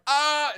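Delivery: high, loud "ah" in imitation; mock reaction yell. Guys seeing a car hit someone, mock high yell. Acting out guys' first reaction to crash.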